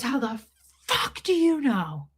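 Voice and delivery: high-pitched mock scream at normal volume; held-back retold scream. Repeating what the lady said, toned-down mock scream. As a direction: imitate her screaming without the full volume. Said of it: "I'm not gonna repeat it the way she did it".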